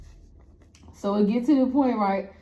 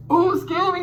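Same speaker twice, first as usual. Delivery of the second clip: burping; 9 semitones higher, 4 dB louder than usual. Real, not acted. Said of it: "You see how I'm burping".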